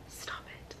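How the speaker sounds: hushed whisper, scolding herself. Real, not acted. Vlogger whispering to herself to stop crying. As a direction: whisper it to hold back tears. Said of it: "oh, I'm gonna cry".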